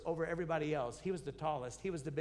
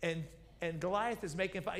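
ordinary delivery

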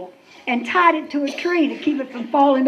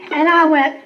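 ordinary talk

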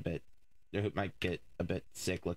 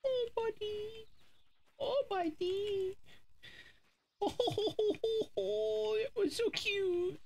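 squeaky praising a dog; 3 dB louder, much slower than usual. Real, not acted. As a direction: coo at a cute puppy in a tiny high voice. Cooing over the dog.

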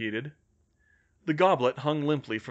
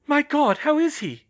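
high, loud anxious cry; alarmed; 8 dB louder than usual. Acted. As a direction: exclaim in pitched-up alarm. Anxious Hermione hearing bad news.

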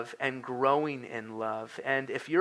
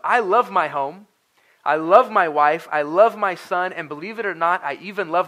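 preaching, louder, each "love" pushed out with force; impassioned preaching; 3 semitones higher, 7 dB louder than usual. Real, not acted. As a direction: lean into it like a pulpit declaration. Preacher declares love, rising force.

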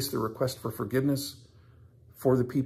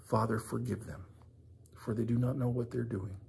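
very soft, solemn recitation; hushed prayer; 3 semitones lower, 10 dB quieter than usual. Acted. Preacher closing on Jesus praying for forgiveness, hushed. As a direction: let the prayer drop to a reverent hush.

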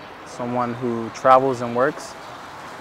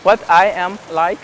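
put-on Hungarian accent; 5 semitones higher, 5 dB louder than usual. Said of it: "So Hungarian".

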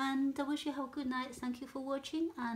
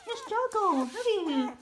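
much higher, louder squeaky pet voice with a laugh; 10 semitones higher, 8 dB louder, slower than usual. Real, not acted. Teasing tired puppy, repeated higher.

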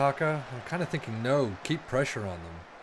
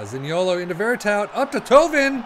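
building to a loud, higher shout on Tovin; louder, higher burst of play-by-play; excited commentary; 6 semitones higher, 8 dB louder than usual. Real, not acted. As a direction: call the pass like a goal is coming. Attack building toward goal chance.

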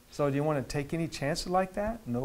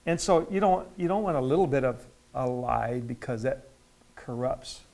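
ordinary delivery

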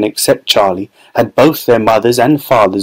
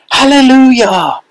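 higher, louder, slow cry of joy; joyful yell; 14 semitones higher, 4 dB louder than usual. A narrator's reading. Grandfather celebrating, drawn-out joyful yell. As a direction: stretch it out like a jubilant old man. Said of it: "yelled Grandpa Joe".

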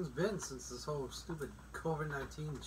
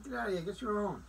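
indignant; 6 semitones higher than usual. Real, not acted. Indignant reaction to story.

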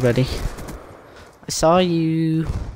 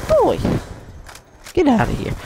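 high yelp; 12 semitones higher than usual. Real, not acted. Reacting in fight.

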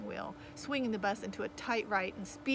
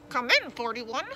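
louder crackling radio call; radio dispatcher; 2 semitones higher, 7 dB louder than usual. A narrator's reading. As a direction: hail the driver over the radio. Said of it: "crackled the dispatcher's voice".

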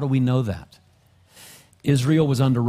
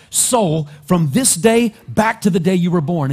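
preaching louder and louder at full force; fervent preaching; 4 semitones higher, 5 dB louder than usual. Acted. Pastor building to the congregation, fervent preaching. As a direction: drive it home to the congregation, rising louder.

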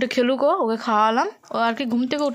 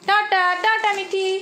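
drawn-out high sing-song goodbye; slow high bye-bye; 7 semitones higher, slower than usual. Real, not acted. Mother says bye to baby. (ধীরে উঁচু গলায় tata)

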